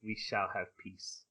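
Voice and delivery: low, soft, slow imitated vow; hushed, measured mock-regal promise; solemn pronouncement. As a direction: quietly intone it as a grave ruler. Voicing a ruler's promise of peace.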